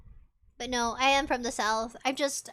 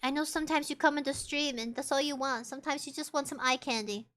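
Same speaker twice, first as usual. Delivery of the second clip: normal talking voice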